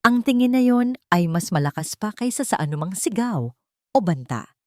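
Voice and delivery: plain reading voice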